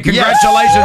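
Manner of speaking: very high, loud congratulations; excited shout. Real, not acted. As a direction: shout it like a winner announcement. Host announces winner excitedly.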